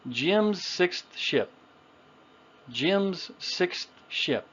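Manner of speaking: short I in "Jim's", "sixth", "ship", word by word; short I sound. Shown as a demonstration. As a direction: spell out "Jim's sixth ship" by ear, slowly. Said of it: "how we can make that short I sound".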